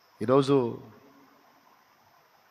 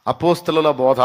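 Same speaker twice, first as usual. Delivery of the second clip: louder, higher pulpit delivery, hammering each point; full-force preaching; 3 semitones higher, 6 dB louder than usual. Acted. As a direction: preach the four points to the congregation. Preaching four points loudly. (పూర్తి బలంతో ప్రసంగం)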